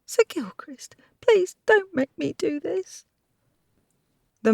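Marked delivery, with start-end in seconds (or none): 0.0-3.0 high, whispered desperate plea; frightened whisper. Acted. Pinned maid whispers to her knight, begging. Maid pleads against the wall.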